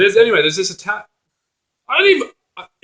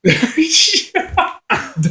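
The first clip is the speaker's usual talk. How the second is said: high laugh; 12 semitones higher than usual. Real, not acted. Laughing at joke.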